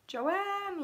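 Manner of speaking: high, happy call-out; joyful high-pitched holler of greeting; happy yelling. Real, not acted. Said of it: "[name] is yelling at Joanne in a happy way".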